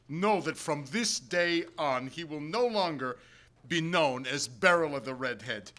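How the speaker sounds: plain reading voice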